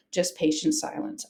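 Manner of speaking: usual speaking voice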